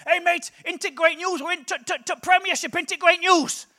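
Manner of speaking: high, loud, excited Huddersfield fan; imitated Huddersfield bloke, excited and stammering; Huddersfield accent. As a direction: gush the news in a broad Huddersfield accent. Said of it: "this is quite a good Huddersfield accent".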